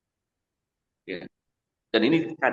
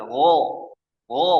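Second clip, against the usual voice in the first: ordinary delivery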